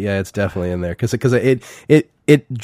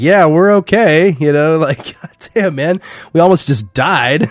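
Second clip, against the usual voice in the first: high, loud, drawn-out retold exasperation; mock rattled protest; 7 semitones higher, 4 dB louder than usual. Acting out divers who just escaped the shark, mock rattled. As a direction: play the shaken survivor, half laughing.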